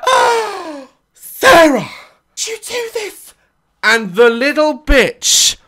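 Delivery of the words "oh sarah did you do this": much higher, sing-song praise; teacher fawning over the child's project; gushing teacher. Retold or imitated. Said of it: "the teacher's like".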